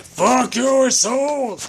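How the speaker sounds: high-pitched, drawn-out cry; mock-demonic slow exclamation; sudden outburst. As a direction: burst out slowly on a high pitch. Sudden outburst after muttered patter.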